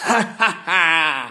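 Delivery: high loud villain snicker; sneering laugh. Acted. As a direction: cackle with cruel glee. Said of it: "ricana Haman". (ricanement méchant, aigu et fort)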